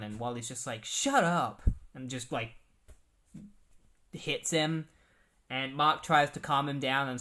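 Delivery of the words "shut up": louder, higher snapped shout; angry outburst. Retold or imitated. Wally snapping at Mark before hitting him, angry shout. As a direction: bite it off in fury.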